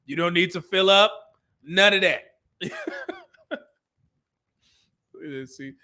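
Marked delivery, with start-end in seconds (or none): 2.6-3.1 high laugh. Real, not acted. Laughs after warning himself.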